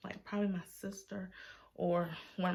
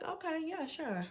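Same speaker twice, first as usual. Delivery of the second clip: high mock agreement; 8 semitones higher than usual. Her reluctant agreement.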